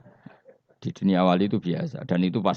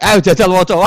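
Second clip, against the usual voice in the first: loud, high mock order; a comic angel yelling read it; loud demand; 11 semitones higher, 10 dB louder than usual. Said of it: "jare Mungkar Nakir".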